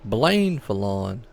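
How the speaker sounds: "Blaine Fallon" drawn out, unsure of the name; slow reading of a name. Shown as a demonstration. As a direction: read "Blaine Fallon" slowly and carefully, as if puzzling over it. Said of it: "two Blakes up here".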